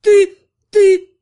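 high, loud broken cry; shrieked indignation; outraged sputter. A narrator's reading. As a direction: burst out, lost for words. Амалика outraged, lost for words.

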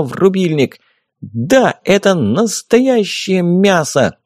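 high, excited play-by-play; fight commentary. A narrator's reading. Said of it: "фея ещё всё это и комментировала".

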